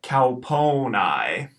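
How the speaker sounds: slow cowponi, cow-po-nye; diphthong demo. Shown as a demonstration. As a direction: model cowponi syllable by syllable. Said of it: "pronounced cowponi".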